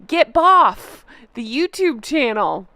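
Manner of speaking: "boff" said slowly and pointedly, like the typo; mock misspelling. Shown as a demonstration. A vlogger mocking the commenter's typo, "boff" stressed slowly. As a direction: drag out "Get boff" slowly with relish. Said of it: "reading again".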